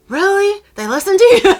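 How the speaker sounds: high, loud mock disbelief; incredulous. Mom doubts anyone listens to daughter, incredulous. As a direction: imitate a stunned, doubting mom. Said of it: "My mom was so incredulous".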